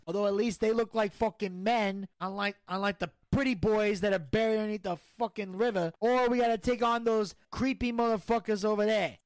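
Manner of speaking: brash gangster bragging and griping; cocky mob boss. Acted. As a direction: play a swaggering mafia don. Plays mobster Tony D in skit.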